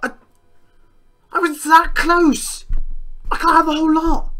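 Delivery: high, loud, indignant shouting; outraged yell. Real, not acted. Gamer robbed of a near victory, yelling in outrage. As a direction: rail at the unfairness of it.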